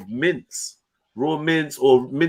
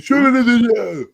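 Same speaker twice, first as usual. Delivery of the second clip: high, louder mock of a bossy demand, laughing; mocking Randy's demand; 9 semitones higher, 6 dB louder than usual. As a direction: parrot his order back with a laughing sneer. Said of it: "Randy thinks I work for him".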